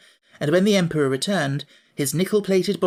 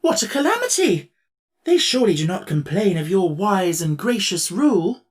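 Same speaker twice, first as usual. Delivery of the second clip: much higher, louder alarmed emperor; dismayed cry; 4 semitones higher, 5 dB louder than usual. Acted. Emperor dismayed at the Scarecrow's news, alarmed. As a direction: wail at the calamity. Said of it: "cried the Tin Woodman".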